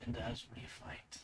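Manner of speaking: hushed, eerie voice from within; whispery unearthly monster voice; disembodied voice. Acted. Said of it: "it's like a disembodied voice".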